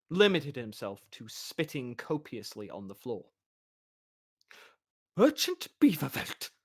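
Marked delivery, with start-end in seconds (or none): 5.2-6.5 high, pompous crowing call; official's crow. Acted. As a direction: crow the title. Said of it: "Swan crowned".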